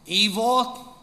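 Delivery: usual speaking voice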